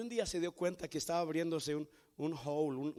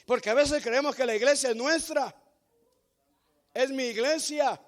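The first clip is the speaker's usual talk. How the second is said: fiery preaching; 8 semitones higher, 10 dB louder than usual. Acted. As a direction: thunder it out to the congregation. Preaching at full force. (predicador que clama con fuerza a la congregación)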